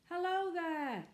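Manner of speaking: high, loud, slow call; shout. A narrator's reading. Boy shouting down from the church tower. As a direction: hail them from far above. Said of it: "shouted Cyril from the church tower".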